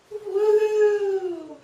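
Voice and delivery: high joyful whoop; excited whoop. Real, not acted. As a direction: let out a happy cheer. Cheer after announcing change.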